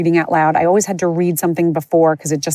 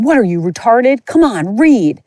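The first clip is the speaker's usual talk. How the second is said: a mean, strict teacher barking, higher and louder on read; mock the strict teacher's scornful snap at a stumbling student; 5 semitones higher, 3 dB louder than usual. Strict teacher mocking a student who misread, scornful. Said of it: "the class giggling and her going".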